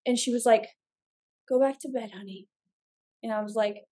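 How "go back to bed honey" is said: soft mom voice. Retold or imitated.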